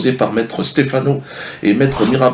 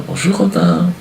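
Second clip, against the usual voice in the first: high, sing-song charity worker greeting; chirpy fake cheer at the bedside; mock sweet fairy; 11 semitones higher, slower than usual. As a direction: coo it like a fake-nice do-gooder. Fairy greets dying boy.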